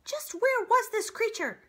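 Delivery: very high, loud storybook creature; questioning animal character. A narrator's reading. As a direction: demand an answer in a high, projected character voice. Crowd member questions the owl.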